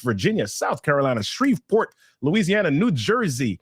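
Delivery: normal talking voice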